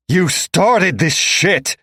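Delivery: loud, slow, dragged-out yell; yelled accusation. A narrator's reading. Captive man yelling at Tink, loud and slow. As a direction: shout it while struggling like hell. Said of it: "I yell".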